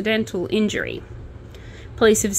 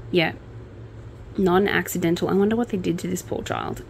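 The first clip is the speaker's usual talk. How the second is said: normal talking voice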